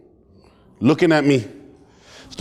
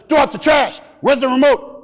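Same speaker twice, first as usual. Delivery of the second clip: high-pitched shouted orders; imitating a dad who only yells; yelling dad; 5 semitones higher than usual. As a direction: bark it like an impatient father. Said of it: "communicated with me is by yelling".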